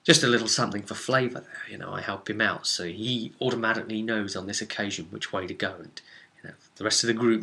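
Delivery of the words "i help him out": ordinary delivery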